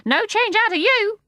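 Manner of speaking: high, loud bird voice; starling teasing Mary Poppins, high; cheeky starling. A narrator's reading. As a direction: chirp it cockily.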